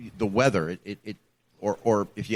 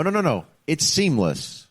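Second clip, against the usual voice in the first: ordinary delivery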